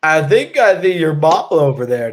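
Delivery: lightly slurred mock-drunk line; a kid faking drunkenness for laughs; imitating a drunk. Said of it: "acting drunk and being like".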